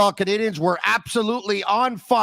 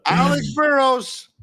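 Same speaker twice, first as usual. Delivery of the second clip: drawn-out rising oh oh oh into a slow loud name; building teasing fanfare for the guest's player; mock hype; 7 semitones higher, 5 dB louder, slower than usual. Host teases his guest with a loud, slow hyped name call.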